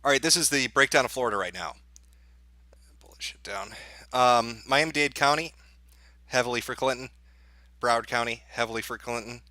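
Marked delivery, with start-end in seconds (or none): 3.0-3.7 whispered aside under his breath; whispering. Real, not acted. Muttered aside to himself.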